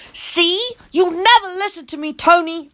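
high, mild mock holler. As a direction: holler at him. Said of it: "I hollered".

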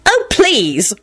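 high, scornful disgust. A narrator's reading. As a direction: scoff at him in disgust. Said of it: "Rosie rejoined disgustedly".

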